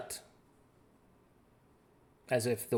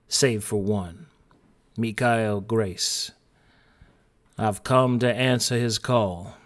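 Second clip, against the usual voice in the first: put-on southern accent; 3 semitones lower, slower than usual. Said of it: "I'm tuning into my southern accent".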